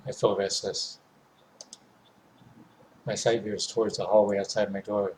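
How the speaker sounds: plain reading voice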